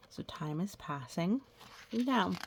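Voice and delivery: plain reading voice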